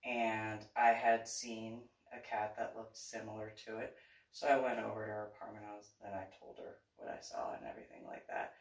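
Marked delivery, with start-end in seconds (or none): none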